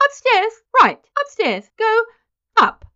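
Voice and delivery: high, loud, panicky directions in bursts; hysterical. A narrator's reading. Nurse sending Mister Button upstairs, hysterical. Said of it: "she cried hysterically".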